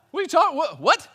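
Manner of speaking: high, loud mock disbelief; incredulous. Customer told to cook his takeout pizza, hammed-up incredulity. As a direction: sputter it out, comically baffled.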